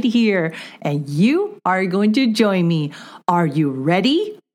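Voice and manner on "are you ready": ordinary talk, nothing marked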